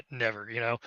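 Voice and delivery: imitating a dismissive laugh; mock laugh. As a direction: laugh it off, then drop the pitch. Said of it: "I would just probably laugh at you".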